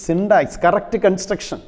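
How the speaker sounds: ordinary delivery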